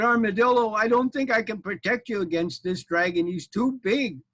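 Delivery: a hint of panic; frightened knight. Knight telling armadillo the dragon is too big, worried.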